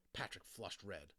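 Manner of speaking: plain reading voice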